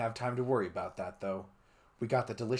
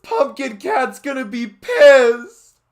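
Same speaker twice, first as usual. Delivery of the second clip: yell; 18 dB louder, slower than usual. Acted. Continued yell.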